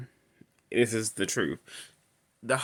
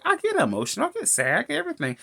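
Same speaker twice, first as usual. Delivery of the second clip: imitating Sally; much faster than usual. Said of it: "she's like".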